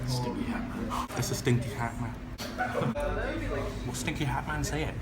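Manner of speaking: soft teasing murmur; mocking murmur. Real, not acted. Teasing a stranger's hat quietly so he won't hear. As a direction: mutter the insult under your breath.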